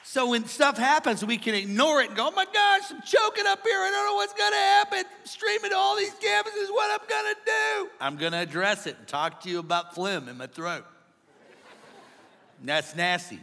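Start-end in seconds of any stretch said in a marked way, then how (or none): 2.3-7.9 high-pitched hammed-up panicked wail; mock panic. Pastor pretending to choke before all campuses, mock panic. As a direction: play at panicking, voice shooting way up. Acting out panic while choking on stage.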